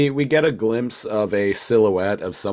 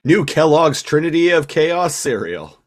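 high, loud cereal ad pitch; cereal commercial announcer; 9 semitones higher, 7 dB louder than usual. Pretend. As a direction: sell it like a breakfast TV ad.

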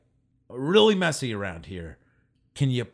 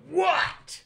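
a sudden high squeaky what, far from his voice; startled lady. Retold or imitated. Homeless lady startled by a stranger, squeaking a high what. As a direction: snap back like a startled old woman.

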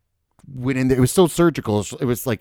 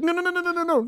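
much higher, mock panicked pleading; panicked protest; faster than usual. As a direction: squeal the no's like a cornered patient. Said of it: "you didn't have a time to be like".

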